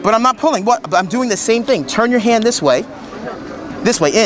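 ordinary delivery

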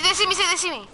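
panicked repeat; panicking gamer. Real, not acted. Panics as enemies spot him.

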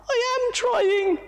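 very high, strained little voice; high strained monkey voice; straining monkey. Acted. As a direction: push the words out as he struggles. Moto strained.

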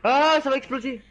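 loud, high excited shout. Real, not acted. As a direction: yell it out as the blast comes. Explosion about to happen in game.

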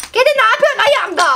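high, loud, fast scolding; real startled outburst; startled yell. Real, not acted. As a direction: yelp at the cat at your foot. Cat attacks her foot.

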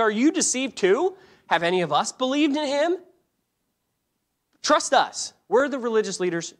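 high-pitched, incredulous challenge; acted indignant rebuke. Pharisees rebuke the returning officers.

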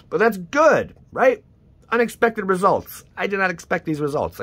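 high and loud, amazed; high surprise. Real, not acted. Reacting to unexpected pulls, high and amazed. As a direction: sound genuinely stunned.

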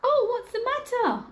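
high, concerned bear; kindly bear voice. Acted. Teacher voicing the bear to year one, high and concerned. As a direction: ask like a worried friendly bear.

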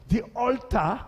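normal talking voice

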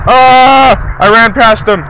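high, loud scream into words; shriek, then cry out in alarm; panicked yell. Real, not acted. Player running past the pursuer, panicked yell.

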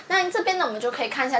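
usual speaking voice

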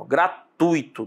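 "gratuito" slowly as gra-TUI-to; careful "gratuito" with ui in one syllable; correct diphthong. Shown as a demonstration. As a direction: stretch "gratuito", stressing the u. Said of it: "aqui em gratuito, ó, você tem um ditongo".

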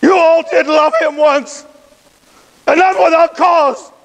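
usual speaking voice

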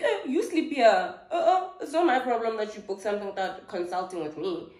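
high, loud sassy comeback; indignant refusal. Retold or imitated. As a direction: push back hard, voice shooting up. Retold quarrel over the hotel room.

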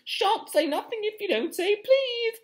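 high, slow, annoying chant; sing-song Peeves. Acted. Peeves teasing Filch for a please, high sing-song. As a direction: taunt in a drawn-out sing-song. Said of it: "in his annoying sing-song voice".

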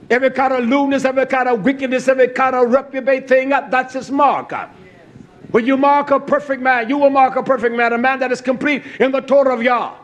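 loud, fast, driving sermon; fiery preacher building to a climax; full-force preaching. Acted. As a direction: preach it out hard to the congregation.

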